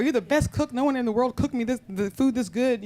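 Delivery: lightly acted gushing flattery; extravagant praise. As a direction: talk up the cook with gusto. Father praising cook, acted.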